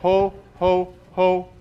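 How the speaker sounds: slow hearty ho ho ha ha; deep belly laugh. Shown as a demonstration.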